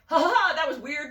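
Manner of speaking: loud, high, dismissive fake laugh; mock laughter. Producers dismissing the token weirdo, mock laughing. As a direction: imitate people laughing off an oddity.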